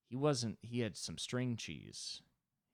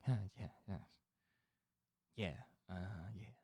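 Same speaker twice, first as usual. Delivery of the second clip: stoned and faking sober; 5 dB quieter than usual. Retold or imitated. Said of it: "And then you're like".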